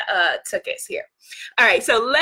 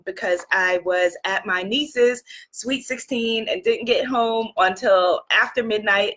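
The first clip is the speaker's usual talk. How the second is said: normal talking voice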